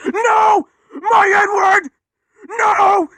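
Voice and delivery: high, loud crying shout; panicked scream. Acted. Edward screaming as he races out of control, panicked. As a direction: scream in terror as you lose control.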